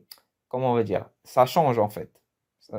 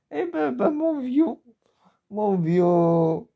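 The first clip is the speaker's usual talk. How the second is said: high, mock-exasperated exclamation; mock exclaim; 10 semitones higher, slower than usual. Teacher voicing a character's reply, high and mock-exasperated. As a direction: play the other guy, exasperated.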